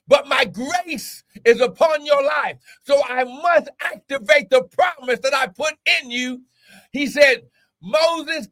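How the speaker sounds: high, drawn-out preacher's cry; full-force preaching. Real, not acted. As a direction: preach it high, leaning on key words.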